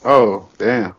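usual speaking voice

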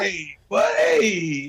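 high slow laughing hey; laughing cry. Real, not acted. Laughing outburst.

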